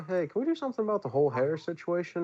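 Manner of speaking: lightly voiced Hemsworth asking; high voice. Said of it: "and I went".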